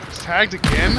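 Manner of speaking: loud disbelieving question; exasperated, can't believe it; surprised. Real, not acted. Frustrated surprise at enemy.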